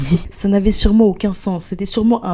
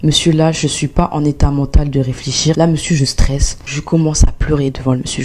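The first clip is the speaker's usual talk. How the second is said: ordinary talk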